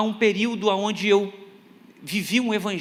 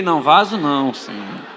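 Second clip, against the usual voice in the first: low, mildly pleading aside; timid plea; 7 semitones lower, 3 dB louder, slower than usual. Retold or imitated. Sick preacher privately begging God, low and pleading. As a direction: sink into a mock plea. Sick man dreading the vigil's call.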